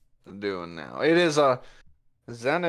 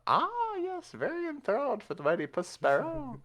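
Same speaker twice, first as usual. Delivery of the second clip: high, grand self-praise; pompous character voice; 14 semitones higher, 4 dB quieter than usual. Acted. Puss Sparrow basking in the party's praise, high and pompous. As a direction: preen like a vain cat hero. GM answers player's joke in character.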